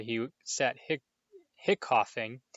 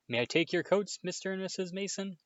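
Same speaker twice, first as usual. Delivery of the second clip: high, overly polite greeting; sweet fake courtesy to guests; polite boy voice; 8 semitones higher than usual. Dudley greets guests downstairs.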